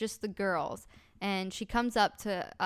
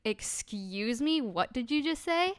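drawn-out start climbing high; affronted disbelief; 4 semitones higher, 3 dB louder, slower than usual. Retold or imitated. Friend standing up to an insulting stranger, indignant. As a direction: imitate an indignant challenge.

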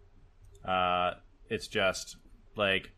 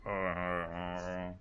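zombie groan; 4 semitones lower, 10 dB quieter, much slower than usual. Acted. Zombies voiced by game master.

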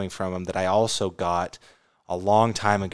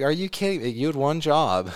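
retold annoyance; 6 semitones higher than usual. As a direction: replay being fed up with a partner's slip-up. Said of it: "you got so mad at me".

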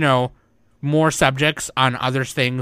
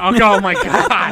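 shocked exclamation; 6 semitones higher, 6 dB louder than usual. Real, not acted. Shocked reaction to joke.

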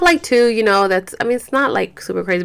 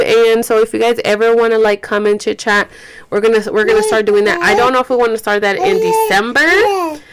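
ordinary delivery

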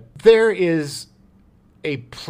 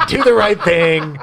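laughing; 4 semitones higher, 9 dB louder than usual. Pretend. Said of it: "No, uh, Say Anything".

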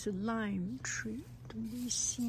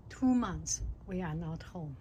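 usual speaking voice

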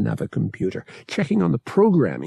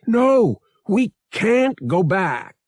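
loud, high, near-panicked protest; frustrated yell; 11 semitones higher, 5 dB louder, slower than usual. A narrator's reading. Pilot refusing to go back, frustrated yell. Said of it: "he yelled, frustrated, on the verge of panic".

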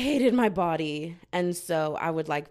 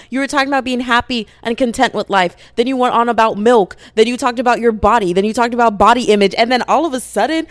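very loud, high-pitched rant; shouted self-scolding, pitched up; exasperated yelling; 6 semitones higher, 17 dB louder than usual. Real, not acted. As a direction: yell at yourself in disbelief. Yelling at herself over tangent.

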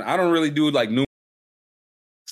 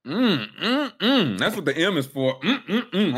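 high mocking hum; 8 semitones higher than usual.

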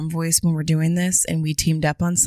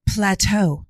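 sultry dom voice. Pretend.

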